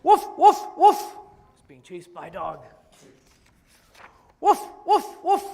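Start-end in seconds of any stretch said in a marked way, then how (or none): 0.0-1.0 loud high woofs; loud yappy barks; barking dog. Acted. Dog chasing Piggy. 4.4-5.4 loud high woofs; loud yappy barks; barking dog. Acted. Dog chasing Piggy.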